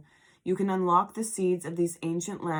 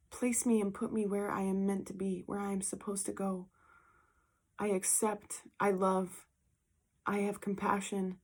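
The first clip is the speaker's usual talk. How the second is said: prayer; 5 dB quieter than usual. Real, not acted. Quiet prayer of surrender.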